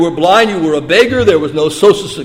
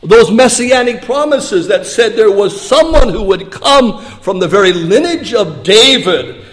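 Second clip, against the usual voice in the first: ordinary delivery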